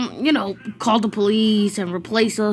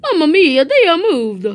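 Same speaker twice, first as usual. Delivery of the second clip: louder, higher mock-Italian exclamation; 4 semitones higher, 5 dB louder than usual. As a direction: exclaim in a hammy Italian accent. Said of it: "I kinda like the Italian voice".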